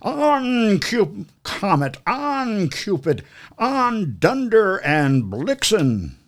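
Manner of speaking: high, slow calling out to the team; Santa calling his reindeer. A narrator's reading. Santa calling out names to his reindeer, high and drawn out. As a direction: stretch each name like Santa rallying reindeer. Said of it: "he whistled and shouted and he called them by name".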